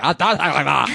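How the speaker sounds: put-on voice, protesting; sister impression. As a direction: deny it in the very voice being mocked. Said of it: "say it in the same voice".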